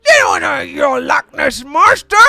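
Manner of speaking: hammed-up meme shout; 1 mock shout. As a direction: bark it out as a meme.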